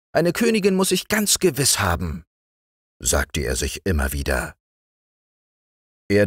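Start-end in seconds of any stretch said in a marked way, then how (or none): 0.1-2.2 much higher and more insistent frog prince; high squeaky frog prince insisting; frog prince, even more excited. Acted. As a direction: climb higher as he repeats himself.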